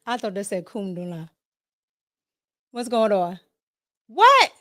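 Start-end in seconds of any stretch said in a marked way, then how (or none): 4.1-4.5 high, loud sudden what; jolted by a comment; startled what. Real, not acted. Host reacting to a chat message, high and loud surprise.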